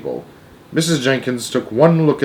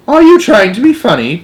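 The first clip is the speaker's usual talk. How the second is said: high, loud, prim indignation; 11 semitones higher, 8 dB louder than usual. A narrator's reading. Mother challenging the grandmother's odd claim, very prim. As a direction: bristle with prim disapproval. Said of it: "very prim".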